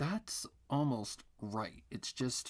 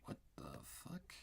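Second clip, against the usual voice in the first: softer, slow frustrated whisper; 8 semitones lower, 11 dB quieter than usual. Real, not acted. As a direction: breathe it out under your breath. Frustrated muttering at drawing.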